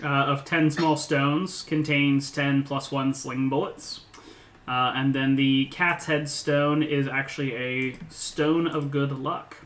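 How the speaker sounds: usual speaking voice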